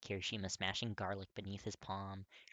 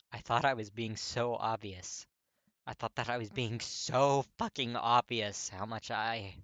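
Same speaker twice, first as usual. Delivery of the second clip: slow and louder, exasperated; frustrated confession; 2 semitones higher, 9 dB louder than usual. A narrator's reading. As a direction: drag out the admission in frustration. Katsuki admitting feelings, frustrated.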